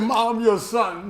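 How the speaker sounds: pleading. Retold or imitated. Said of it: "I said".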